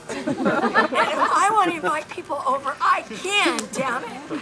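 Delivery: defiant. Retold or imitated. Inner defiance about inviting strangers.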